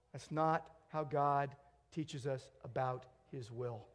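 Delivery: normal talking voice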